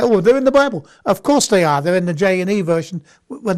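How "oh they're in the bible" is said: mock objection. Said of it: "Now, you say".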